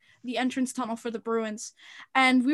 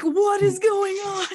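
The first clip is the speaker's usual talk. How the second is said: baffled surprise; 7 semitones higher, 4 dB louder, slower than usual. Retold or imitated. Phone blowing up in class.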